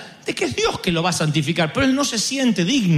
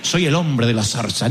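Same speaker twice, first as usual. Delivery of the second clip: lower, self-satisfied boast; smug mock Moses; 7 semitones lower than usual. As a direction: play a smug hero. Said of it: "No dice". (Moisés presumido, en burla)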